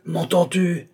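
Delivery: high, insistent call; yelling. A narrator's reading. Ignored old man yells at silent Balsamo, insistent. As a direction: demand to be heard. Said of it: "hurlait Altotas".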